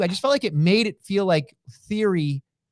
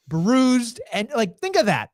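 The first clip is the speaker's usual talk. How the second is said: ordinary talk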